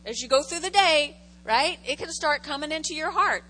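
usual speaking voice

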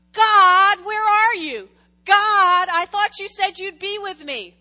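very loud, high wailing plea; desperate cry to God. Acted. Israelites loudly crying out to God in the wilderness. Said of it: "this is what it is".

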